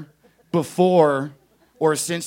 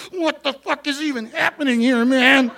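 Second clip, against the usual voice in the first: put-on confused kids; 9 semitones higher than usual. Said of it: "They were just like".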